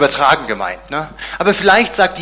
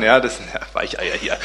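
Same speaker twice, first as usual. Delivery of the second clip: mocking; 7 semitones lower, 5 dB quieter than usual. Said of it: "Darüber kann Petrus nur lachen".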